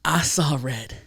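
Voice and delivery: hushed, breathy storytelling emphasis; dramatic whisper. Real, not acted. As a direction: whisper it slowly for effect.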